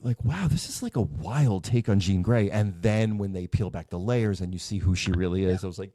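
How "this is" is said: usual speaking voice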